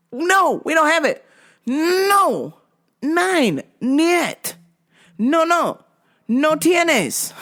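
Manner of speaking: high, drawn-out mock insistence; exasperated refusal. Worker refuses customer in several languages, high exasperated. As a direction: spell it out as if to someone not understanding.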